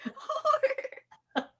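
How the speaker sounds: very high, very slow laughing squeal; high giggling Willow-style squeal. Retold or imitated. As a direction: squeal the one word slowly up high. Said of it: "not even your mom's a".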